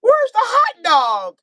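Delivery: high, slow whiny dog asking; pleading dog voice. Retold or imitated. Dog asking its owner for the hot dog, high and slow. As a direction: beg like a confused dog. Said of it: "And the dog like".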